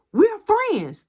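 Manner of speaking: put-on girlfriend throwing his word back, high and loud; high bitter jab. Girlfriend mocking his word friends, high and bitter. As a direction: spit the word back at him.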